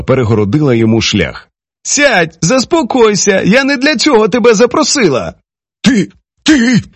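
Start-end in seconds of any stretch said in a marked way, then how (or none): 1.8-5.3 high-pitched firm order; woman reining in a quarrel; commanding woman. A narrator's reading. Narrator voices woman taking charge. 5.8-6.8 high, halting stammer; choked-up high-pitched accusation; sputtering in rage. A narrator's reading. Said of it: "Несподівано став затинатися він".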